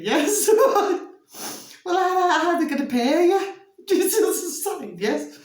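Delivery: high, fast, giggling; cracking up mid-question; laughing. Real, not acted. Laughing at absurd idea.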